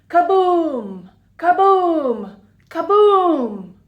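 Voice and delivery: usual speaking voice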